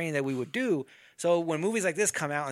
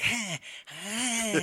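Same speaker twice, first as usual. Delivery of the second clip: mock fencing grunts; 7 semitones higher than usual. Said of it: "they're like".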